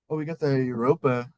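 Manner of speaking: normal talking voice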